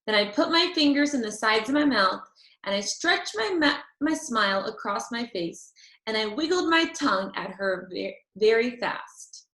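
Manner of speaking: ordinary delivery, nothing marked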